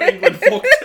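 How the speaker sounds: loud laughter through the words; cracking up. Real, not acted. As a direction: barely get the title out for laughing. Cracking up at the title.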